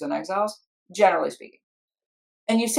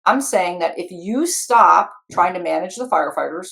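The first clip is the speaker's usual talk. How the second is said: ordinary talk, nothing marked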